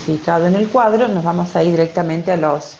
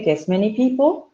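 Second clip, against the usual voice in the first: ordinary talk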